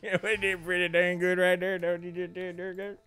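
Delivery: put-on high, loud, terrible King of the Hill Texan accent. Riffing on cornbread in a bad King of the Hill accent. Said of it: "terrible King of the Hill accent".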